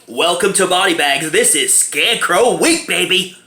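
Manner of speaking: loud, drawn-out host intro; show-opener hype. Pretend. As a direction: kick off the show big, stretching the words. Show opening called out to viewers.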